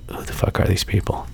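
hushed, disgusted whisper; whispered inner thought. Retold or imitated. Annoyed parent thinking at the rude family, whispered. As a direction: breathe it out as a private thought. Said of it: "I'm just thinking to myself".

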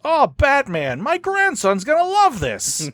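big, very high excited imitation; Gary Marshall. Director spots a fan's Batman shirt, big imitated voice. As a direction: stretch it out slowly in a big, high delighted voice. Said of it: "In that big Gary Marshall voice".

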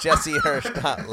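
high squeal through laughter; laughing while saying it. Real, not acted. Joking about vanity domain names.

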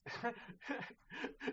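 real breathless giggle with no voice; airy laugh. Real, not acted. Friend remembering a silly match, breathy laugh. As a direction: laugh quietly at the memory. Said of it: "so I'm laughing now just thinking about it".

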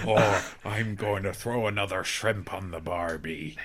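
slow hammed-up Aussie pirate voice; pirate Australian accent. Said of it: "just a pirate voice".

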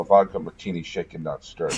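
low, soft bad British accent; horrible British accent. Boy ordering a drink from the waiter, horrible British accent. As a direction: mimic a poor British accent, dropping away quietly. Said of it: "in a horrible British accent".

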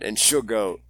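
normal talking voice

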